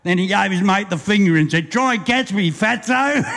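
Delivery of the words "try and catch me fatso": higher-pitched cheeky jeer; mock-bold schoolyard taunt; taunting dare. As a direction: goad him into the chase. Drunk taunts cop while fleeing.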